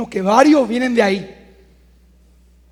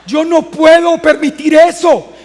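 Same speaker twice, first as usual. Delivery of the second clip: loud, slow, higher preaching cry; slow it right down and shout that you can't allow it; emphatic shout; 5 semitones higher, 7 dB louder than usual. Real, not acted.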